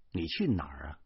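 low, soft asking; subdued question. A narrator's reading. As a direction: ask it quietly, voice held down. Husband's question, softer first take.

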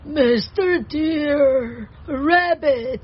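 very slow, drawn-out bawling; exaggerated tearful plea, dragged out; crying bear. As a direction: wail like a storybook bear in tears. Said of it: "Cried Bear".

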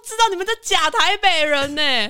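high, fast mocking tease; teasing. Real, not acted. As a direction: rib them playfully. Teasing the hosts.

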